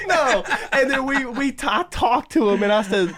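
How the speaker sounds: high laughing retelling. Real, not acted. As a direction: tell it through laughter.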